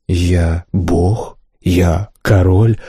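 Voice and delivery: slow, distant otherworldly voice; a spirit chanting from another world; ghostly voice. A narrator's reading. Голос духа повторяет. (потусторонний голос)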